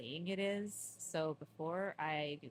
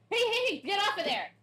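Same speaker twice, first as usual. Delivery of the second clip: sharp, much higher, louder shout; yelling at cats; 13 semitones higher, 8 dB louder than usual. Real, not acted. Owner shouting at cats climbing the drying rack, real loud yell. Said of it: "while I yell at my cats".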